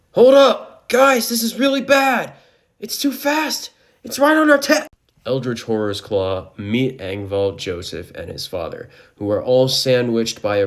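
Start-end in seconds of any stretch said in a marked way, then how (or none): 0.1-4.9 high, loud panicked shouting. A narrator's reading. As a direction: shout in rising panic, cut off at the end. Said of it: "Joseph and Angval begin to panic".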